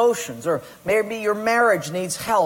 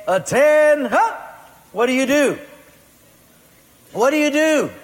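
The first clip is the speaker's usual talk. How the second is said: barked order, much higher and louder; drill sergeant shout; 8 semitones higher, 7 dB louder than usual. Acted. Sergeant calling recruits to attention with a loud bark. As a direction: snap the room to attention.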